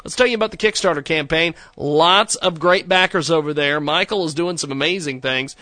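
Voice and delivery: loud, upbeat radio ad voice; ad read. Acted. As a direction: sell it like a pitchman. Host reads sponsor ads.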